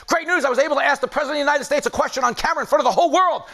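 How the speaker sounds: a rushed, breathless report of the big news; excited young reporter. Retold or imitated. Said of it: "He's like".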